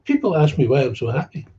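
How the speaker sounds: mock the cheerful sponsor. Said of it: "There's another one".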